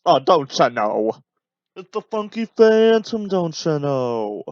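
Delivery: bad, loud cartoon-ghost voice, slowing to a drawn-out "don't ya know"; Funky Phantom impression. Joking over an old wrestling match, in a bad cartoon-ghost voice. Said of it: "That was my bad Funky Phantom impression".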